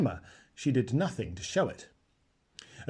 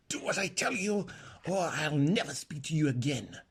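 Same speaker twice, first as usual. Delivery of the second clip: soft, fierce hiss; quiet urgent warning; hissed threat; 3 semitones higher, 4 dB quieter than usual. A narrator's reading. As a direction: threaten her under your breath. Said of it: "hissed Aravis".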